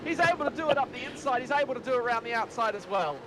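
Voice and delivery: fast, excited race call; calling the overtake as it happens; motorsport commentator. Real, not acted. Live race play-by-play.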